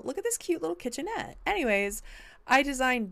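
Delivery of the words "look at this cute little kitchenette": high, fast and excited; gushing. Real, not acted. Showing viewers the kitchenette, higher and fast with delight. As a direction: show it off with delight.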